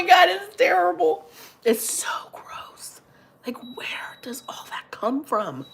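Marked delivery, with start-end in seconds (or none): 1.6-5.6 a hushed, grossed-out whisper. Real, not acted. Whispering to chat after blowing her nose, grossed out. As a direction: whisper it like you're ashamed of the noise.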